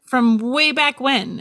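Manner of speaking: usual speaking voice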